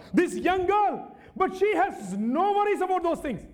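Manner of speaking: high, building sermon cry; fervent preaching. Real, not acted. Preacher imagining Naaman coming back humiliated, fervent preaching.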